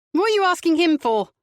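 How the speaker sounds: high, loud angry snap; snapped. A narrator's reading. Mother snapping at the detective questioning her son. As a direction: bite her head off. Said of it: "his mother snapped".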